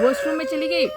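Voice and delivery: Washroom में चली गई squealed high and loud; retelling the storm-off, pitch shoots up. Real, not acted. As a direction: blurt the repeat with a burst of energy.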